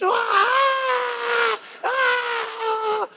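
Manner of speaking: very high, drawn-out fan shriek; mock swooning wail, held long; fainting fan scream. Overseas fan fainting at the sight of a celebrity, hysterical scream. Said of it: "And then they faint".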